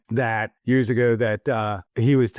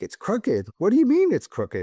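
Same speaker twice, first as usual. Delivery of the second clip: higher, incredulous retold question; disbelieving; 9 semitones higher than usual. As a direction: act out your own baffled reply, climbing up on "mean". Retold own disbelief at grandfather.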